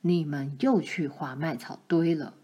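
low, stern accusation; a hint of a grave father; father confronts his daughters, low and stern; stern-faced father. A narrator's reading. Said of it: "他板着脸说".

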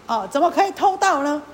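loud rebuke leaning on 盗; scolding with the weight on 盗. Acted. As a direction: hit 盗 hard, like an accusation. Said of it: "这个莲花神就骂他".

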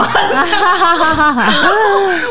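high, loud laugh with a near-sob; helpless laughter. Real, not acted. Friend recalling her embarrassment, laughing hard. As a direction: laugh till it almost cries.

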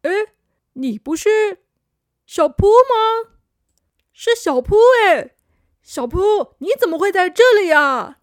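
put-on high, loud astonished boy voice; surprised. Older boy surprised to meet little friend, high and loud. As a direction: sound amazed to find him here. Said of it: "很惊讶地说".

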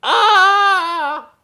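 high, loud, drawn-out "ah"; long theatrical holler up high; story cry. Acted.

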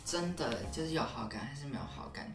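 ordinary delivery

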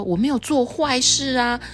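high-pitched storybook protest; acted high protest; protesting innocence. Voices Omu protesting to policeman.

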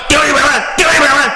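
mock demand. Shown as a demonstration. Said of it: "大声跟他说我要一百万".

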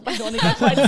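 a loud plea, laughing through it; laughing plea to a bug. Retold or imitated. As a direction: beg it to leave while cracking up. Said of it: "隔着纱窗跟它喊话". (隔着纱窗边笑边大声求蟑螂走开)

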